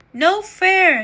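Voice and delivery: loud, high yell from the crowd; yelled protest. A narrator's reading. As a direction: shout your complaint at the field. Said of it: "yelled someone in the crowd".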